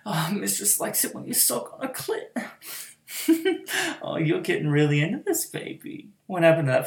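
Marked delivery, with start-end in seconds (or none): 3.1-4.0 high falling laugh; high amused laugh. Acted. Mistress laughing at her eager pet, high and falling. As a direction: laugh up high and let it fall.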